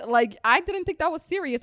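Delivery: high-pitched retold disbelief; mom's disbelief. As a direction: imitate a stunned mom protesting. Retelling mom's shocked reaction.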